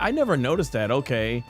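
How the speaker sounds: normal talking voice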